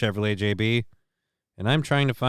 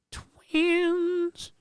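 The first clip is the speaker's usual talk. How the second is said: squeaky high; 5 dB quieter than usual. Real, not acted. Squeaky cut-off word.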